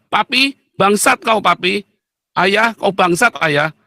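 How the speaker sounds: high-pitched acted child's insult; cursing child. Your child cursing you to your face, insolent. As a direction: play a child spitting curses at father. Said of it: "Ketika anakmu bilang".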